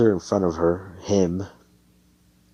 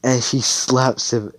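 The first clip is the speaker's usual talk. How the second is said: usual speaking voice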